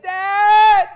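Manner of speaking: high, loud pleading cry; brother's yell. Retold or imitated. Brother crying out to Dad mid-spanking, yelling. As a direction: imitate a spanked boy yelling out. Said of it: "I'm hearing my brother just yell".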